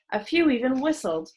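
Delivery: ordinary delivery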